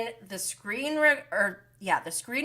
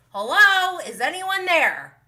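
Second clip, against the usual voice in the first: sarcastic hello; 8 semitones higher, 7 dB louder than usual. Acted. Mocking Tom's cluelessness.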